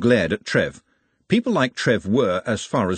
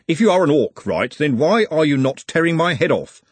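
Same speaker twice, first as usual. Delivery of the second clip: higher, louder puzzled challenge; challenging Bledlow; 7 semitones higher, 5 dB louder than usual. Acted. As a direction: press the question with raised pitch.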